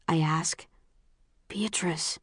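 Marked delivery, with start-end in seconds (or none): 1.5-2.2 hushed, breathy whisper of a name; urgent hushed whisper; quiet warning. A narrator's reading. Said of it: "Caleb says quietly".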